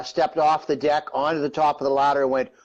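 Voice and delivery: ordinary delivery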